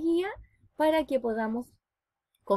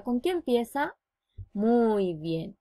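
ordinary talk, nothing marked

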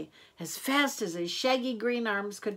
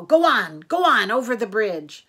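impatient dismissal; 9 dB louder than usual. A narrator's reading. Troll grudgingly waves goat on.

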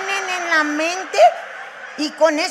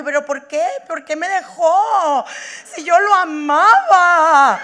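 hammed-up wail, higher and louder, drawn out; mock crying; 3 semitones higher, 5 dB louder, slower than usual. Comic imitating someone still crying over an ex, mock crying. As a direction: wail it slowly like a jilted lover, overdone. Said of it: "hay gente que todavía está llorando".

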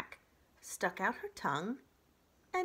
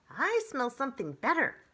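higher, louder sly fox; 7 semitones higher, 7 dB louder than usual. Acted. Fox leaving the robot, higher and sly. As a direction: tease as you trot away.

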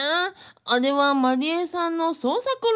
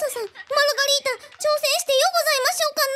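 much higher, fast, squeaky put-on character voice; 12 semitones higher, much faster than usual. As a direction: jump way up into a quick, strange character voice. Margarita asks in her voice. (甲高く早口の作り声)

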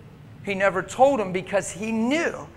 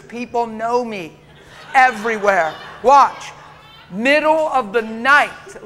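louder, ringing preacher's build; full-force preaching; 5 dB louder than usual. Acted. The pastor preaches hard to his church about love. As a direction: drive it home to the congregation.

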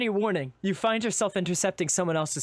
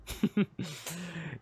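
chuckle under breath; 3 semitones higher, 5 dB quieter than usual. Acted. Cloaked man amused by your eagerness, quiet chuckle. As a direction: chuckle softly to yourself. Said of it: "chuckles under his breath".

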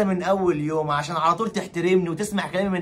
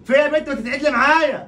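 high, raised-pitch rebuke; heated, high-pitched snapping; angry scolding; 6 semitones higher than usual. Acted. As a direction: tell her off with rising temper. Husband scolds wife.

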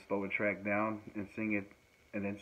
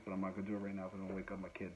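normal talking voice

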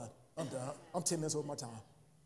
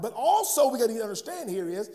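loud, ringing preacher's cadence; preaching at full force; 5 dB louder than usual. Acted. Pastor pressing the congregation on God's holiness, preaching loud. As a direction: drive it home from the pulpit.

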